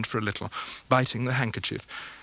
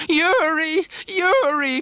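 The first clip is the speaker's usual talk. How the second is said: put-on much higher, louder pleading old woman; crying mother; 7 dB louder, much slower than usual. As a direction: cry out to her son, begging. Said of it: "cried the mother".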